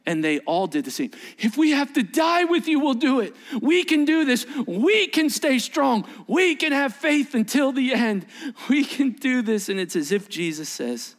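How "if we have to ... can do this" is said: high, fervent group pledge; rally the others with rising conviction; impassioned vow, pitched up; acted rallying cry. Disciples all echo Peter's bold vow.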